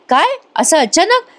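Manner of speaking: high, slow startled question; lightly acted surprise; surprised. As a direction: caught off guard, rising in pitch. [name] startled by sudden dinner.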